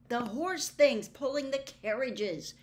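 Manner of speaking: Harry impatiently pointing out the horses. A narrator's reading. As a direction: push the point, losing patience. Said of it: "said Harry impatiently".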